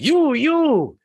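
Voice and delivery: put-on high, loud, furious old-world landlord yelling; screaming Italian landlord. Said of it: "screaming at the top of his lungs".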